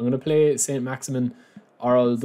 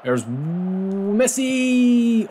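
drawn-out excited call; 8 semitones higher, much slower than usual. Real, not acted. Gamer calling Messi's run like a commentator, long excited call. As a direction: stretch the name long and high, like a commentator. Live chance in football game.

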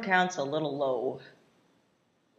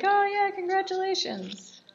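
mock congratulations; 13 semitones higher than usual. Friend hears John is having a baby, mock congratulating. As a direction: put on a high-pitched well-wisher's voice. Acted reply to baby news.

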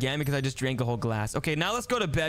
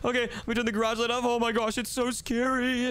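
higher, frightened voice; play-scared; 6 semitones higher than usual. Pretend. Brother turning off garage light, scared. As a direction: stretch the fear out on the last words.

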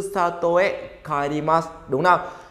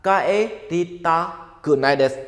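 slow model reading; slower than usual. Shown as a demonstration. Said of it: "Không muốn về sẽ là".

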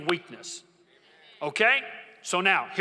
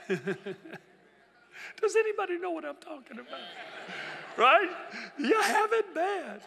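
laughing high; 11 semitones higher, slower than usual. Real, not acted. Laughing while preaching.

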